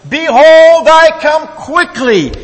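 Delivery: very high, loud, slow shout; shouting with strength. Acted. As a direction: bellow the verse with full force. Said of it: "for the third time with much strength".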